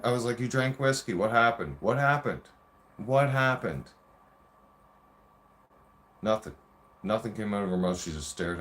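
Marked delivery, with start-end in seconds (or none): 0.4-3.9 demanding, raised voice pressing for an answer; heated, higher, insistent grilling; worked-up confrontation. Retold or imitated. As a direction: hammer the question home in a retold row. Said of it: "I get excited just talking about that shit".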